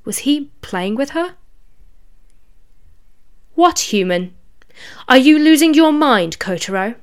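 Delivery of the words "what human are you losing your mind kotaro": scornful, loud brush-off; sharp retort. Acted. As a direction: snap back with disdain. Rabbit demon deflects sharply.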